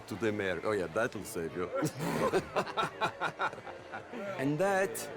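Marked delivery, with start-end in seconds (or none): 1.7-4.3 rising scornful laughter; mocking laugh. Host scorning a guest's letter, scornful laughter.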